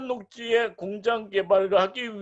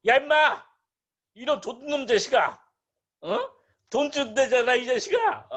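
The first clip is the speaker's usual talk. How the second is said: loud, high-pitched mock scolding; hammed-up angry outburst at a refusal; mock cursing; 7 semitones higher, 3 dB louder than usual. Buyer cursing a landowner who refuses, hammed-up angry shout. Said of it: "욕하시면 돼요".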